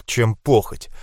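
ordinary delivery, nothing marked

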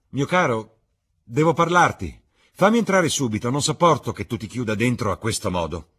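loud, high urgent call through a door; a friend pounding to be let in, insistent; Lord Henry through the door. Acted. (Lord Henry che chiama forte e acuto dietro la porta)

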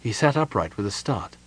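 ordinary delivery, nothing marked

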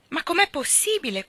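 high outraged yell; shouting in disbelief. A narrator's reading. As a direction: yell it as an indignant old master. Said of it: "continuava a gridare".

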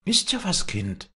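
put-on high-pitched, concerned old woman; worried old nurse. Old nurse questions the girl, concerned. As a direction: fuss over the child in a high voice.

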